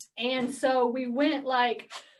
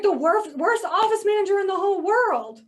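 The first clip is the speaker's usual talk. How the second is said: exasperated outburst; 7 semitones higher, 6 dB louder than usual. Retold or imitated.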